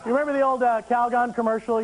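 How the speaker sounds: usual speaking voice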